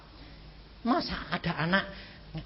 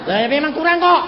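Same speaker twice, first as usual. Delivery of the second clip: louder and higher, ringing out; loud preaching; 10 semitones higher, 10 dB louder than usual. Real, not acted. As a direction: project it to the back of the hall. Preacher calls out to crowd. (berseru lantang kepada jemaat)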